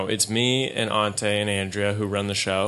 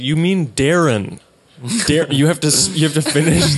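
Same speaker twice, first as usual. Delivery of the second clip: mock exasperated; 6 semitones higher, 7 dB louder than usual. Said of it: "'Cause he was like".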